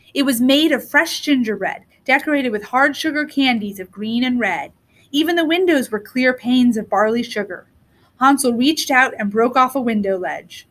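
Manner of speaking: plain reading voice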